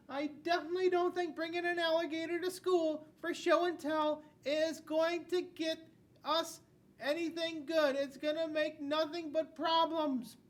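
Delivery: wag a finger as the strict teacher; stern teacher voice. Acted. Teacher in the book scolding a student, high and loud. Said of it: "she will tell you".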